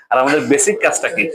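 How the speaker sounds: normal talking voice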